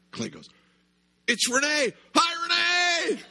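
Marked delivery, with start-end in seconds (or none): 1.3-3.2 high, loud happy shout; excited kid. Retold or imitated. Little boy spotting Renee, shouting with high glee.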